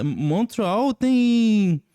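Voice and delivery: ordinary talk, nothing marked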